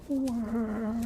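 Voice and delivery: very high squeaky mock meow; cat cry imitation. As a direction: squeal it like a little kitten. Mimicking the cat's cry.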